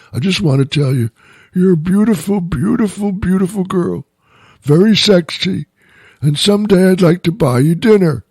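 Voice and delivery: put-on sweet old man flattering a young woman; elderly man voice. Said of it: "this is my elderly man voice".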